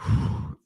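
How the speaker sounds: long breathy sigh of release; big exhale. Shown as a demonstration. Host showing fans' relief after the win, deep exhale. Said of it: "such a deep breath, like, exhale".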